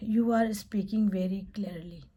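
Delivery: teacher praising a student's speaking; slow model phrase. Shown as a demonstration. Teacher giving slow, clear praise. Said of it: "तो मैं बोलूँगी".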